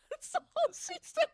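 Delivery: high giggle through the words; can barely get it out for laughing; real laughter bubbling up. Real, not acted. Cracking up retelling the mix-up.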